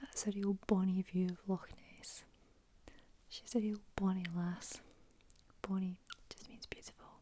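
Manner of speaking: Scottish words ASMR whisper. Real, not acted. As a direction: whisper it gently like a bedtime secret.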